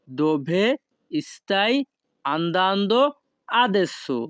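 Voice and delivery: each word of "Dove stai andando adesso?" set apart by pauses; slow separated words for a class. Shown as a demonstration. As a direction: break the question into single words for learners. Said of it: "এই বাক্যের Italian ভাষা হবে".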